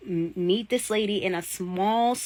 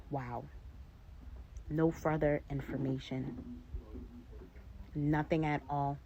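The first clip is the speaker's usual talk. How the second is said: much softer, deflated disbelief; stunned hush; 3 semitones lower, 11 dB quieter than usual. Retold or imitated. After the call, quietly stunned by the airline's non-answer. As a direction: let it sink in, barely above a murmur.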